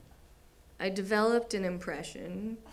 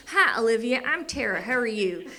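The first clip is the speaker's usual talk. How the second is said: chirpy therapist greeting, pitched up; Tara, Louisiana therapist; 3 semitones higher, 4 dB louder than usual. Retold or imitated. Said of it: "she's like".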